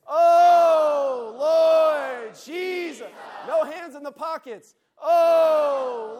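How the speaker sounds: slow, very loud cry of Oh, Lord Jesus; preacher leads the room calling Oh, Lord Jesus; shouted Oh Lord Jesus. Shown as a demonstration. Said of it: "now we're gonna add Jesus".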